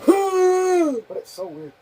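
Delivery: high, slow, strained zombie groan; Half-Life zombie voice. Shown as a demonstration. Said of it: "Half-Life zombie voice impression".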